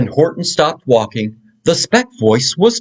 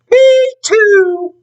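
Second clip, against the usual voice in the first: tiny squeaky copycat voice, very high; baby kangaroo; 4 dB louder than usual. Acted. Young kangaroo echoing its mother, very high squeak. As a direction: squeak it like a cheeky little joey. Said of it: "the young kangaroo in her pouch said".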